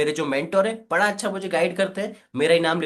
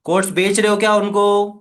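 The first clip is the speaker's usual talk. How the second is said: louder, firmer challenge; tone raised a bit; 4 semitones higher, 8 dB louder than usual. Shown as a demonstration. Trainer redoing the question to customers, louder. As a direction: push the question out harder. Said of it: "थोड़ी सी tone high करो".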